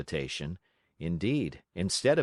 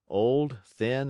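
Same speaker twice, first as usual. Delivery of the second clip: ordinary delivery, nothing marked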